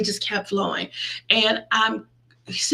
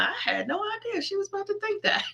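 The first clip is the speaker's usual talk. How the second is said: high, quick, startled little voice; lightly mocking surprise; mock surprised voice; 10 semitones higher, 6 dB quieter, faster than usual. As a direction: play it like someone caught off guard. Mock God caught off guard.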